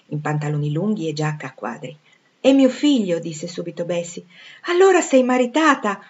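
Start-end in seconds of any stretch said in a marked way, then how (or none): 2.4-3.2 loud, high, eager introduction; excited announcement. A narrator's reading. Bessie presents her small son to Jane, proudly excited. 4.6-6.0 loud, high, delighted surprise; surprised question. A narrator's reading. Jane learns Bessie has a child, asking in surprise.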